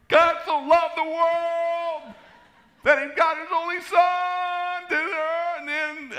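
very high, slow, full-volume yell; comically overdone high shouting. Retold or imitated. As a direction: bellow the verse in long stretched words. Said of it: "Louder, Don, louder".

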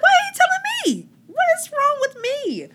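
high, loud anxious cry; panicked despair. Retold or imitated. Others tell her what God said, high panicked cry. People relay God's words to her.

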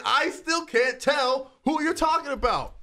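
high, slow baffled complaint; hammed-up confused retort; mock exasperation. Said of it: "I'm like".

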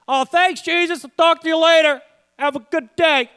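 high, loud, breezy brush-off; mocking an ungrateful leper hurrying off; dismissive thanks. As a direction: toss off a quick thank-you and leave. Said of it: "I don't want to be known as the nine lepers".

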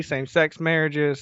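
usual speaking voice

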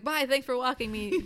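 mock breezy departing classmate; chirpy goodbye. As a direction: toss off a cheery bye as you leave. Retelling Manabe's cheery exit.

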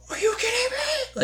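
very high, squeaky, laughing outburst; outraged disbelief. Retold or imitated. Flipping out over a prank.

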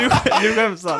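laughing shout. Real, not acted. Laughing at embarrassed Fredrik.

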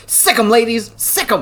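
hammed-up cheerleader for the pile-on; mock egging on. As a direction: cheer them on in a silly voice.

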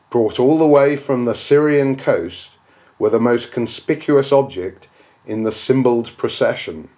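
ordinary delivery, nothing marked

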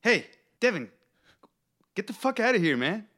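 high angry yell. Pretend. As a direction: shove him away with a yell. Acted yell, high and loud.

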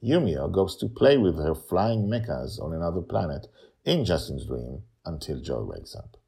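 usual speaking voice

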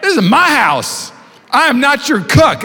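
high, slow, put-on grumpy dad voice; gruff dad. Dad refusing the kid's taco request, slow and put-on. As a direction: stretch it out like an offended father. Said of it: "He was like".

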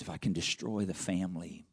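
low, hushed, plotting voice; sinister scheming devil. Retold or imitated. Preacher voicing the devil plotting against homes, hushed and sinister. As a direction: let the adversary whisper his plan. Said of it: "He says".

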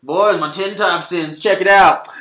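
a lightly hammed-up proud Billy; proud mock show-off. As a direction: show off a photo to the boys. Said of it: "he's like".

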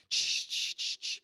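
quick repeated "shh" sound effect; voiceless bursts mimicking the sausage work; imitated shushing noise. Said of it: "and I was like".

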